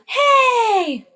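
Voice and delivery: high, loud calling yell in the wrong placement; wrongly placed yell. Shown as a demonstration. Teacher shows students what is not right, high loud yell. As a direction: demonstrate a faulty high belt. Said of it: "that's not right".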